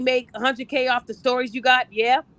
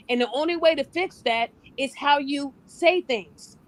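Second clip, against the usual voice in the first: ordinary delivery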